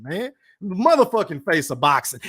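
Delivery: ordinary delivery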